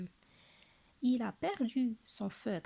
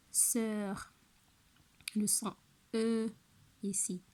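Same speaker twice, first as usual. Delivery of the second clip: ordinary talk, nothing marked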